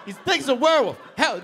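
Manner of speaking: high, loud panicked shouting; hammed-up cry for help; frantic protest. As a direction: shout it desperately to the rescuers. Protests to counselors while attacked.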